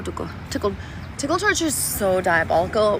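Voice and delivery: normal talking voice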